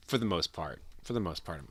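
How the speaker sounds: normal talking voice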